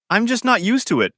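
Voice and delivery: usual speaking voice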